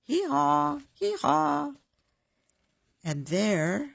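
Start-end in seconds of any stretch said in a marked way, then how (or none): 0.0-1.7 high hee-haw bray; high imitated donkey hee-haw.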